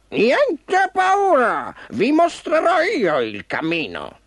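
high, drawn-out bird call; friendly bird. A narrator's reading. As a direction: reassure them in a stretched bird voice. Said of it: "la voce amica dell'uccello nero".

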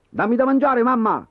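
high, bossy order; commanding. Acted. Boy ordering his mother to feed him, bossy. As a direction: demand it like a little tyrant. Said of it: "comandò".

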